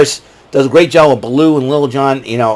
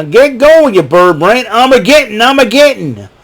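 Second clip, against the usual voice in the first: higher cartoon sidekick voices; Sheriff and Trigger lines; 8 semitones higher, 3 dB louder than usual. Sheriff orders Trigger, higher cartoon voices. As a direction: bark the order, then answer eagerly. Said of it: "Trigger and Nutsy are my favorite".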